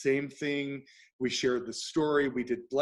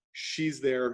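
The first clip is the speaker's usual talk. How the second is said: ordinary talk, nothing marked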